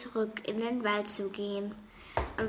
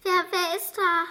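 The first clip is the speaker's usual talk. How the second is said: higher and louder, stammering the first word; a scared girl stumbling over her words; frightened stutter; 9 semitones higher, 6 dB louder than usual. A narrator's reading. Leonie stammers at the rustling in the woods, frightened. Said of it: "stotterte Leonie".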